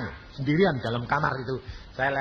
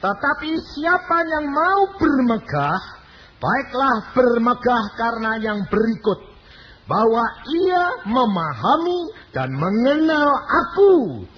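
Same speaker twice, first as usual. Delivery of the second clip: scripture reading; 8 semitones higher, 6 dB louder than usual. Acted. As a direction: read the word of God like a herald. Reads Bible verse aloud.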